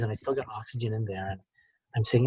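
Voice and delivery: usual speaking voice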